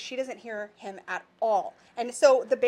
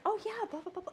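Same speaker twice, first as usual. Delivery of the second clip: dismissive mock; 8 semitones higher, 10 dB quieter than usual. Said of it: "And you're like".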